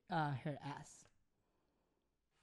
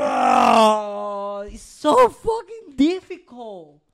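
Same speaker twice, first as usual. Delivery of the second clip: exasperated outburst; 11 dB louder, much slower than usual. Real, not acted. Venting to friends about English from England, exasperated outburst. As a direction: cry out in exasperation, stretching the words.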